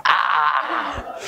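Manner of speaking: Darth Vader-style scream. Retold or imitated. Dad reacts to his ruined car, mock Vader scream. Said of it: "like Darth Vader".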